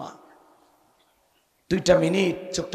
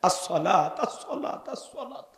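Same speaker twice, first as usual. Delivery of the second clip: very soft, fading repeats; last breath, barely audible; dying Prophet's last words; 7 dB quieter than usual. Acted. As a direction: let it fade to a faint whisper. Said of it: "আপনাকে আমাকে বলে গেলেন".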